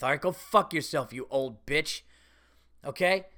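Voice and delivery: ordinary talk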